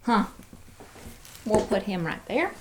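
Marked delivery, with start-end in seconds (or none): none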